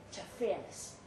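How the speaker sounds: usual speaking voice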